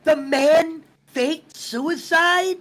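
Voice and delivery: slow disbelief. Retold or imitated. Leo asking the interviewer about Faye, slow disbelief. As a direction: drag out the question in disbelief. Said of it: "he goes".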